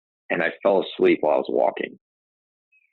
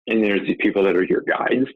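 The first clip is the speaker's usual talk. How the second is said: usual speaking voice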